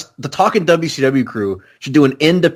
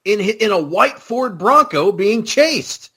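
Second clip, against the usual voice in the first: mock sitcom dad; 7 semitones higher, slower than usual. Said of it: "He's like".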